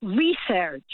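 "research" stressed on a long first REE; disapproved research pronunciation. Shown as a demonstration. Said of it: "It's not research".

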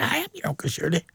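soft secret whisper; whispered aside, playful. Retold or imitated. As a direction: breathe it like a secret to a child. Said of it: "I said".

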